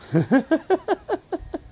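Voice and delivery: sudden high giggle at himself; amused high-pitched laugh; high burst of laughter. Real, not acted. Laughs at his own realization.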